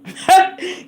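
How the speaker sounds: high bursting laugh; cracking up, real laugh; burst of laughter. Real, not acted. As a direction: laugh out loud at the joke. Laughs at own phrase.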